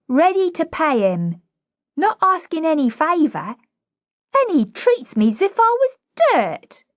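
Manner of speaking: put-on high cockney girl, pleading her case; indignant, high-pitched plea; aggrieved flower girl. Flower girl explaining she wants lessons, aggrieved.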